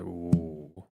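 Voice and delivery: normal talking voice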